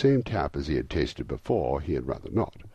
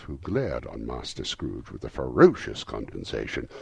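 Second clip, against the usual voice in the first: usual speaking voice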